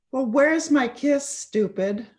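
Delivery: punchline quote, a touch indignant; mock-gruff demand. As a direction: call out a cheeky demand to your brother. Sick brother's taunt voiced in poem.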